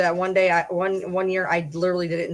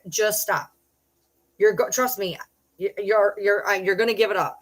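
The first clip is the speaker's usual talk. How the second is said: normal talking voice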